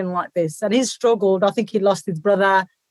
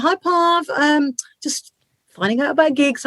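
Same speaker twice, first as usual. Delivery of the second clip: students' voice; 6 semitones higher than usual. Retold or imitated. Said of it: "my students are like".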